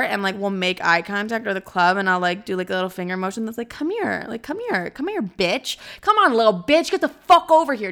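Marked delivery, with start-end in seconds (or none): 3.7-7.9 over-the-top come-here. Pretend. Imagining an outrageous beckon across the bar, hammed-up. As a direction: call him over like a hammed-up brute. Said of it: "could you imagine if I did do that".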